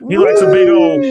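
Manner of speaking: high, loud laughing fit; cracking up mid-sentence. Real, not acted.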